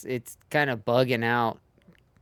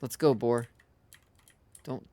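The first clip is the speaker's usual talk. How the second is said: ordinary talk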